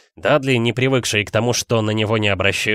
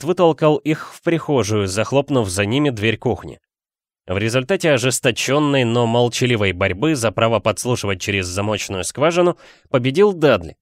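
plain reading voice

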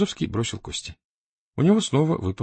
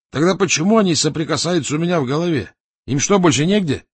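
higher, louder annoyed questions; irritated; 8 semitones higher, 6 dB louder than usual. A narrator's reading. Raduev complaining about what gets into his head, irritated. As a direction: demand it, pitched up and exasperated. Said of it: "раздражённо сказал Радуев".

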